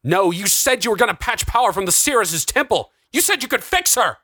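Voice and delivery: high, desperate protest; climbing pitch, outraged and desperate; explosive accusation. A narrator's reading. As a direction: hurl the promise back at him. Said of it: "Something inside Jonathan exploded".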